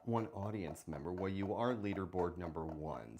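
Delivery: normal talking voice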